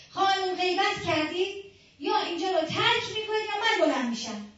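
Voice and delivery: loud, high stern rebuke; retold shout. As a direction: call her out sharply for gossip. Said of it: "فریاد زد". (با صدای بلند و تند سرزنش کن)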